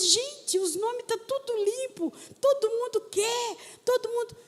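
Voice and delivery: high-pitched, amazed exclaiming; retold astonishment. Seeing that all the names are clean, amazed. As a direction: relive the delighted disbelief. Acting out her own amazement.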